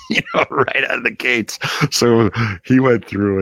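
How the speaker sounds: laughing. Real, not acted. Laughing about David's rough start.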